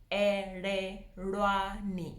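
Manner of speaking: "Ererwani" in slow, separate syllables; slow breakdown. Shown as a demonstration. Teacher teaching the L's, slow syllable-by-syllable. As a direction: stretch out "Ererwani" for learners, L-like not R. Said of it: "say it slowly, as slowly as you can".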